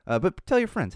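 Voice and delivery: ordinary talk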